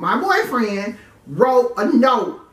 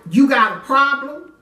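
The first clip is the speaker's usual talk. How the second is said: sharp raised challenge at a stranger; mom confronting; 6 semitones higher, 4 dB louder than usual. Retold or imitated. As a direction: call him out from the doorway. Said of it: "she ran to the door and was like".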